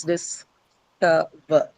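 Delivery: dis-CO-ver drawn out slowly, weight on CO; slow stress demo. Shown as a demonstration. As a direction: stretch out discover, hitting CO. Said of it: "On the second syllable".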